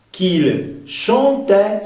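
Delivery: "chantait" ending on an open eh; teacherly slow "chantait", final open E. Shown as a demonstration. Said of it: "sonido E abierto".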